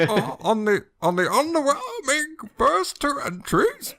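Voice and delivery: voice cracking high. Acted. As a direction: let the voice crack up like puberty. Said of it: "his voice is cracking".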